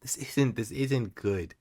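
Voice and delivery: quiet dismay. Real, not acted.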